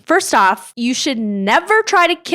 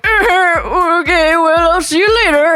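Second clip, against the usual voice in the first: higher, louder flustered mock voice; raised, flustered mock voice; mock flustered brush-off; 6 semitones higher, 5 dB louder than usual. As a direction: hurry him off the door. Dale hiding, fobbing off Easy.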